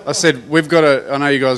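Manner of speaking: insistent. Real, not acted. Bandmate correcting who said what, loudly insistent.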